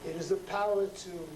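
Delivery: slow, measured poem reading with long pauses; poetry reading. Acted. Poet reading his street poem to a live audience. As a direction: let each image land, then pause.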